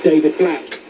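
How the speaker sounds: ordinary talk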